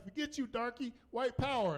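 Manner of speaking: imitating a high-pitched angry heckle; mock hostile retort. Homeless man rebuffing the neighbor's request, high mock hostility.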